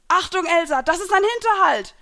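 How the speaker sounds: high, loud alarm call; urgent battlefield shout; shouted warning. A narrator's reading. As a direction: yell a warning across a distance. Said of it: "hörte ich Grays Stimme aus der Ferne".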